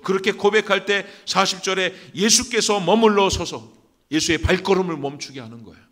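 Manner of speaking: high, loud exhortation; full-force preaching. Real, not acted. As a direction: drive it home to the congregation at the top of your voice. (힘차게 외치는 설교)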